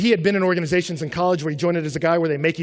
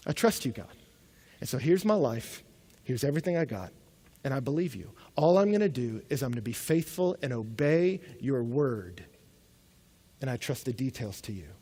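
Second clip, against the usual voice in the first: softer, lower, hushed trust; quiet surrender; 4 semitones lower, 6 dB quieter than usual. Retold or imitated. Abraham quietly handing his life to God, hushed. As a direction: let it settle into quiet trust.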